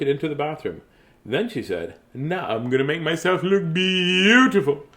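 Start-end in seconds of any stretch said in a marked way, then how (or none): 2.1-4.8 higher, slow, stretched final word; hammed-up self-admiring declaration; vain Julie. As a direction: announce it grandly, savouring the last word. Julie announces her plan.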